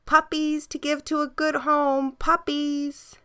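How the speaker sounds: put-on high, bright calling of children hawking puppies; kids calling out. A narrator's reading. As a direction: call it out like kids at the park. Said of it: "walked to the park shouting".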